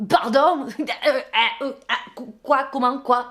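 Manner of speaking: loud incredulous what; stunned disbelief. Real, not acted. Narrator reacting to being called the solution: shocked, sputtering. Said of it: "j'ai pas compris là".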